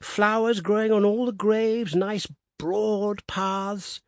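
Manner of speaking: put-on high, slow quavering old voice; old Woodifield quavering about the cemetery. A narrator's reading. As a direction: let the old voice tremble upward. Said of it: "quavered old Woodifield".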